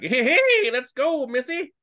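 very high, loud mock Texan cowboy call; Texan accent. Disguised Joker keeps up the ruse and calls to the lady in a hammed-up Texan accent. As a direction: holler it like a cartoon Texan. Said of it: "Good old Texas Joker".

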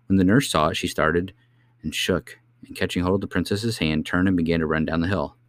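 ordinary delivery, nothing marked

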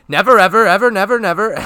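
loud, high mock exasperation; annoyed outburst. SpongeBob fed up explaining to Patrick, imitated loud and high. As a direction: belt it out like an exasperated cartoon character.

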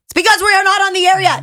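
high, loud protest; exasperated outburst. Acted. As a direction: shout the explanation in exasperation. Mock exasperated explanation at end.

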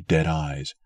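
ordinary delivery

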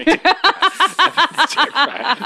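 laughing proud. Acted.

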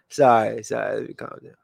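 giggling through sorry; laughing apology. Real, not acted. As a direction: laugh it off, embarrassed. Laughs off her own outburst.

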